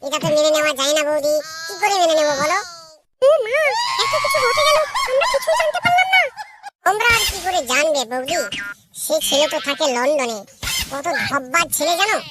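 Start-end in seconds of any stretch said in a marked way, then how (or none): 3.3-6.2 high female voice crying out in shock; shocked sister-in-law. Acted. Sister-in-law hears the scandal, high and astonished. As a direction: gasp at the news, voice climbing.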